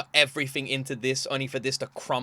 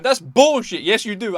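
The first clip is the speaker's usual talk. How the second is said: higher, louder mock scolding; mock angry boss; 6 semitones higher, 6 dB louder than usual. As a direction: snap it back like a harsh coach. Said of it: "and he's going".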